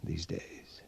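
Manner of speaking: wary conspiratorial whisper. Acted. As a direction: breathe it so no one else hears. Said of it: "What are you whispering about?".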